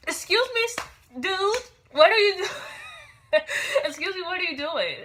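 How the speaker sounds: high, loud, incredulous challenge; indignant confrontation. Retold or imitated. Woman confronting a man at her ATM, indignant. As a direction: retell it with shrill-pitched, laughing disbelief.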